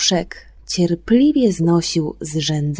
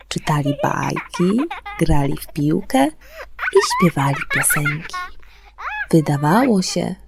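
story narration; 3 semitones lower than usual. A narrator's reading. Radio host to the children listening, bedtime story narration. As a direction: tell it like a bedtime fairy tale. Fairy tale read on air.

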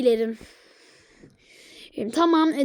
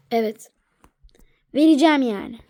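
normal talking voice

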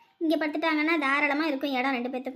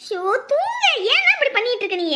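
high, raised-voice plea to sleep; exasperated scolding; 8 semitones higher, 5 dB louder than usual. Acted. Woman telling the crying child to sleep, exasperated scolding. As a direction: snap loudly at a child who won't settle.

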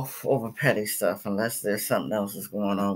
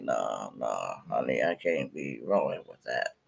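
low, softer voiced refusal; mock refusal; 12 semitones lower, 7 dB quieter than usual. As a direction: turn honey down quietly, pitch dropped low. Voicing a godly person refusing.

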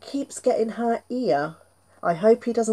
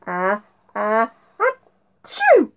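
fake sneeze; 4 dB louder, much slower than usual. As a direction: build up then sneeze. Wind-up then sneeze.

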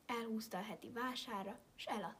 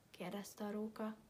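ordinary delivery, nothing marked

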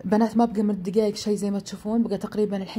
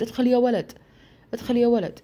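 higher-pitched retold call to enter; 5 semitones higher, 3 dB louder than usual. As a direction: call through the door, lightly raised. Calls to whoever knocks at door. (ينادي من خلف الباب بصوت مرتفع)

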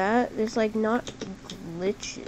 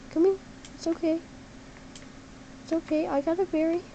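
coaxing; 6 semitones higher, 4 dB quieter, faster than usual. Real, not acted. Coaxing the fox.

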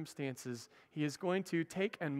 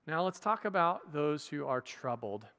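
ordinary delivery

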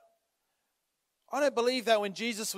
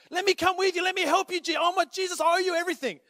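higher eager pleading voice; pleading freed man; 6 semitones higher, faster than usual. Pretend. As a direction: beg to follow him. Freed man begging Jesus.

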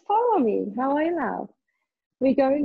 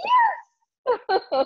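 excited shout; 12 semitones higher than usual. Real, not acted. Leader cheering class.

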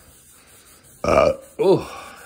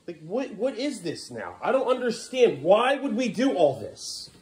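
exasperated questioning; 8 semitones higher, slower than usual. Real, not acted. Exasperated at child's mess.

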